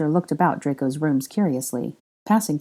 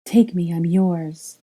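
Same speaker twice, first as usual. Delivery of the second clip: mock seductive; much slower than usual. A narrator's reading. Granger playing seductive.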